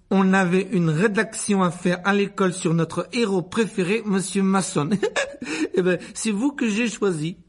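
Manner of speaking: high, giggly flattering schoolboy; fawning Dudley rehearsing. A narrator's reading. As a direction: recite the compliment, giggling proudly. (garçon flatteur, voix aiguë et rieuse)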